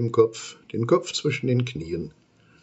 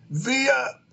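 much higher threatening monster; warning cry. Acted. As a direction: warn him off sharply. Monster warns against tickling.

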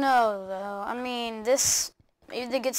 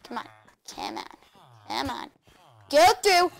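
normal talking voice

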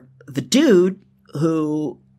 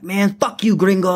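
higher-pitched retort, imitating an angry drunk; mock defiance; 5 semitones higher than usual. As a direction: act out the party crasher talking back. Retold party crasher defying the host.

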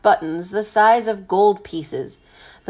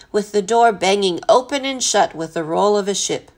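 ordinary delivery

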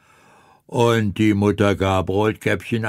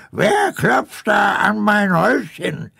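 gruff demand; 7 semitones higher than usual. Acted. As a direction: snap at the door like a suspicious old woman. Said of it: "fragte sie barsch".

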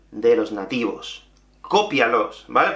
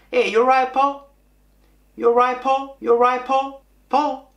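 high, run-together Manchester English; fast Manchester greeting; 8 semitones higher than usual. Retold or imitated. Imitating the coworker greeting him at work, fast Manchester accent. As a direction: imitate a quick Mancunian greeting, pitched up. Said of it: "me lo decía muy rápido".

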